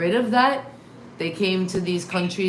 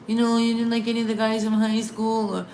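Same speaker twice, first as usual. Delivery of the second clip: mom's voice, a bit higher; lightly mimicked mom; 5 semitones higher than usual. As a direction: imitate her mother's nagging, lightly. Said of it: "my mom would be like".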